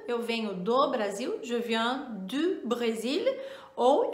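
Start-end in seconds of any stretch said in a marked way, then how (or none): 1.4-3.2 slow careful French review phrases; slow French model sentences; review examples. Shown as a demonstration. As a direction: read the examples out clearly. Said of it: "frases de exemplo".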